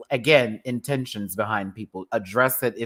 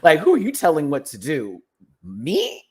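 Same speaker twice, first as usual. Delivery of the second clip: indignant; 4 semitones higher, 5 dB louder than usual. Real, not acted. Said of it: "repeat of rhetorical".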